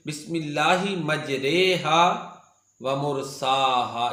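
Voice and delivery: slow, मजरेहा with re bent towards e; imala recitation. Shown as a demonstration. The teacher recites the one imala in Surah Hud slowly. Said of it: "इसको मजराहा नहीं पढ़ेंगे".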